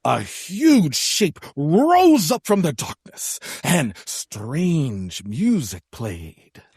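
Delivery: slow, drawn-out ominous telling; spooky storytelling. Acted. Co-narrator hyping a huge shape in darkness, slow and spooky. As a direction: stretch the words for eerie suspense.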